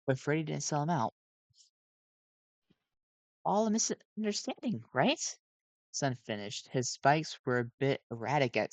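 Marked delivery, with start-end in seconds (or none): 3.4-5.4 high, nervous animatronic voice; jittery gentle robot voice; Sun voice. A narrator's reading.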